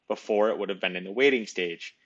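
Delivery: ordinary delivery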